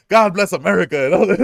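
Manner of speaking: high, loud patriotic proclamation; hammed-up patriot. Loki as Captain America, hammed-up patriotic shout. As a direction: belt it like a flag-waving hero.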